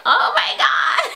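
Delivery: high, loud drawn-out fangirl scream; starstruck squeal. Retold or imitated. Fan rounding the corner and seeing the band, starstruck squeal. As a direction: squeal it out, re-enacting the thrill. Meets idols, retold excited scream.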